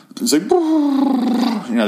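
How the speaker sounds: a high, long, slow warbling hum like a spaceship; imitated spaceship noise. Describing the opening noise to listeners, imitating a high spaceship hum. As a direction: mimic the eerie spaceship sound. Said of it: "which sounds like this weird spaceship".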